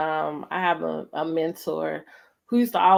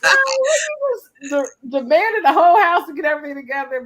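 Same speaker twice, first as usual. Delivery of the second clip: excited retelling; 11 semitones higher, 6 dB louder, faster than usual. Retold or imitated. Mimicking demanding father.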